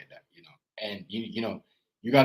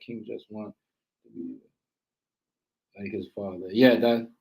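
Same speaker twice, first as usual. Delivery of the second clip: usual speaking voice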